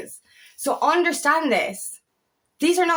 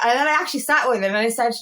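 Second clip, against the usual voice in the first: ordinary talk, nothing marked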